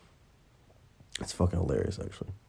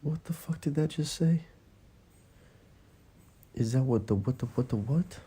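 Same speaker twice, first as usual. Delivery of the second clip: higher voice, stunned and puzzled; real surprise; 7 semitones higher, 3 dB quieter than usual. Real, not acted. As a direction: caught off guard by the donation voice, asking what it just said. Stream donation voice surprises her.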